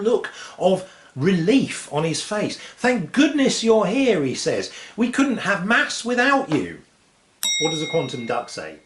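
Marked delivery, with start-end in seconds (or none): 2.8-4.2 high, slow, relieved priest. Acted. 5.0-6.8 high, slow, relieved priest. Acted.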